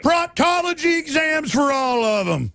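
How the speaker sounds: high, slow, shouting cop; mock cop shout. Host mocking police, high shout. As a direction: bark it like a mad cop.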